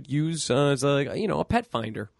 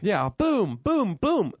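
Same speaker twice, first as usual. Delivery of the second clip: mock gunshots; 6 semitones higher, slower than usual. Imitating gunshots.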